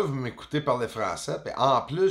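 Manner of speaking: ordinary talk, nothing marked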